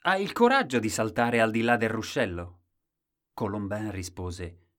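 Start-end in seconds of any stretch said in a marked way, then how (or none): 0.1-2.6 loud, pushy dare; loud storybook taunting question; challenger's dare. A narrator's reading. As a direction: throw down a challenge loudly. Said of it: "Quando qualcuno disse".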